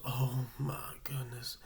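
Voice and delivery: slow whispered alarm; worried whisper. Retold or imitated. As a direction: whisper it slowly in worry. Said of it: "She goes".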